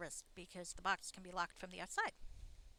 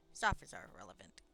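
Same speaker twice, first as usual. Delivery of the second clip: deep gruff companion voice, much lower; a dismissive warrior, pitched way down; 7 semitones lower than usual. Acted. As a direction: growl it like a stern ally. Companion voice arguing against deal.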